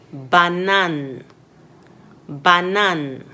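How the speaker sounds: banane with open A, no English vowels; clear French A. Shown as a demonstration. Teacher models French A for students. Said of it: "words that have A in them".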